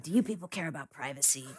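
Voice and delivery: lightly imitated raspy stranger at the door; hint at his rasp, skip the accent. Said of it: "he has this raspy voice".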